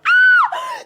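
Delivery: very high, loud squeal of delight; fangirl shriek. Real, not acted. Fan recalling first seeing the sinners, squealing out loud. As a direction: let out a thrilled fan squeal. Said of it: "thirty more seconds to fangirl".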